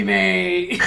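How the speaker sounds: breaks into high laughter; cackling at his own joke; laughing. Real, not acted.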